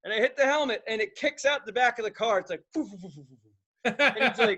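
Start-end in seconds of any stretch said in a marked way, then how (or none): 2.7-3.9 imitated tumbling noise. Helmet tumbling sound.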